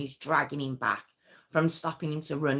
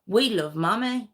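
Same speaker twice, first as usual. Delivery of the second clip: a hint of a playful child; lightly voiced boy answering back; child's cheeky reply; 5 semitones higher, 4 dB louder than usual. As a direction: son treating it as a game. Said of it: "one of the boys would go".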